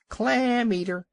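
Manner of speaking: high shrill taunting yell from the crowd; jeering scream. A narrator's reading. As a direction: scream it like a whole colony of birds mocking. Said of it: "yelling and screaming".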